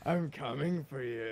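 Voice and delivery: menacing hunter. Pretend. Hunter teasing the trapped player.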